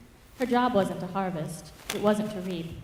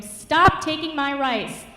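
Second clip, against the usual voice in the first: high, loud, drawn-out shout; furious slow yell, pitched up; roared command; 7 semitones higher, 8 dB louder, slower than usual. A narrator's reading. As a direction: roar slowly at a thief. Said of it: "she roared at him".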